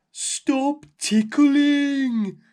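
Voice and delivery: very slow, loud booming giant; ticklish giant. A narrator's reading. Girl bouncing on the giant's tongue, giant booms slowly. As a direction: boom it out while being tickled. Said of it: "said the big voice".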